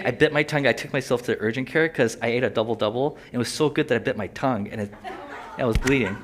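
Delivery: ordinary talk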